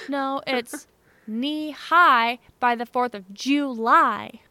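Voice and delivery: country twang. Shown as a demonstration. Correcting her boyfriend in the car, slow country twang. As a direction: lean into a rural Midwest country twang, slowly. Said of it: "that little country twang in there".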